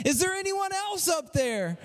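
acted climber's plaintive call upward; shouting up. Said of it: "the man shouted up again".

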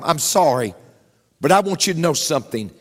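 ordinary talk, nothing marked

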